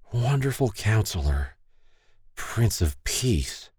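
slow drugged slur; slurred. Acted. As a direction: slur it out slowly, half asleep. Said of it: "Stacy slowly slurred".

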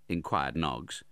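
ordinary delivery

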